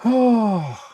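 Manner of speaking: loud high sigh then groan; exasperated sigh. Real, not acted. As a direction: let out a big frustrated sigh. Another software failure.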